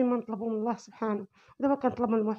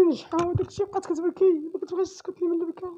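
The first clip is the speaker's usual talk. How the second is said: tearful; 5 semitones higher than usual. Real, not acted. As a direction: voice breaking with tears. Mother tells of daughter's crying. (صوت باكي)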